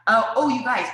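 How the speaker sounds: bursting with excitement; sudden eager outburst; excited. Real, not acted. Sudden excited aside to viewers.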